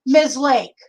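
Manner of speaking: mock receptionist summoning the next patient; calling out a name. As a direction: call the patient's name across a room. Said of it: "they look around the room and they go".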